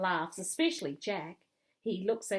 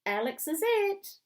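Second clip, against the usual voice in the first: loud, high excited invitation; eager playground yell; 13 semitones higher, 6 dB louder than usual. A narrator's reading. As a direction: call a friend over to join the game. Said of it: "he yells".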